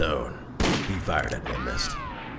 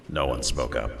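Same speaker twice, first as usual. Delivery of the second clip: ordinary delivery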